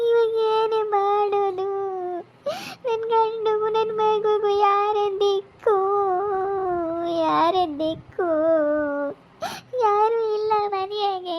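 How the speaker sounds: high, slow crying lament; wailing. Acted. Wife wailing that nobody will care for her and her child. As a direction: weep out the helpless questions.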